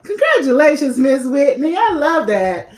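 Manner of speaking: bright, loud congratulations; excited. Real, not acted. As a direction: cheer her on with delight. Host praising guest's achievement.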